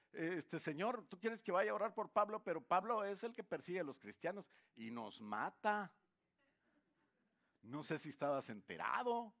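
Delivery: más alto y fuerte, protestando; reclámale al Señor sin creerlo; Ananías incrédulo. Acted. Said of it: "Ananías dice". (Ananías objeta al Señor, alarmado e incrédulo)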